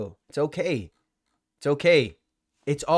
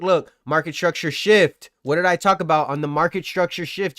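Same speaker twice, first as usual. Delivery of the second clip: ordinary talk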